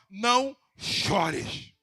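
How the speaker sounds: slow tender comfort. Acted. Jesus comforting the widow.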